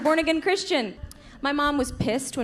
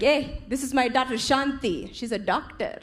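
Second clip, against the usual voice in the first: proud Indian dad. Retold or imitated. Dad bragging about daughters.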